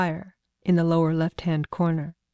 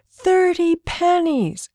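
put-on high, very slow little girl's demand; demanding child; 12 semitones higher, 5 dB louder, much slower than usual. Little girl insisting on her price to Zanja, high and slow. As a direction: drag it out like a stubborn small girl, pitched high. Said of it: "she demanded".

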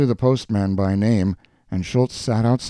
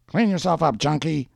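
higher-pitched heckler at a window; retorting voice; 8 semitones higher than usual. A narrator's reading. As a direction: answer back in a raised character voice. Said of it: "the voice responded through an open window".

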